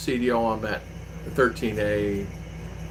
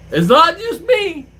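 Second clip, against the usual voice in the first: exasperated cry; 7 dB louder, slower than usual. Retold or imitated. Exasperated emphatic claim.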